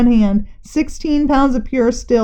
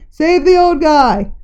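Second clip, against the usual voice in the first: slow, pleading call; voices ringing out; 5 semitones higher, 3 dB louder, slower than usual. A narrator's reading. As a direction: plead for the old man. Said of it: "Voices rang out".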